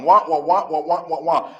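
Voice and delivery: muted trombone wah wah, mock; Charlie Brown adult wah. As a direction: imitate the garbled teacher from Charlie Brown. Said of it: "You sound like the adults on Charlie Brown".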